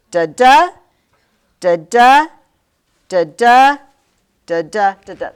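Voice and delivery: loud "da da" five times, each second "da" punched; emphatic weak-STRONG "da da"; iambic pentameter beat. Shown as a demonstration. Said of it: "It's iambic".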